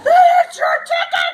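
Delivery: high, loud, laughing shout; excited shout. Acted. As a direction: burst out with the winner news. Announcing Joy won.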